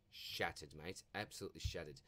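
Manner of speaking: low, soft mock despair; hammed-up heartbreak over ninth place; softly groaning in mock gloom; mock shattered. Laments finishing ninth in his league.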